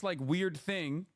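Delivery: ordinary talk, nothing marked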